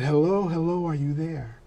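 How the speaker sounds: softly checking someone is still on the line; retold caller, hushed and tender; gentle phone check. Friend on phone, first soft telling.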